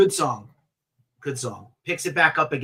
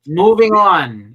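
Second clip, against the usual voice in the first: announcer; 4 semitones higher, 10 dB louder, slower than usual. Real, not acted. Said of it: "announcing next topic".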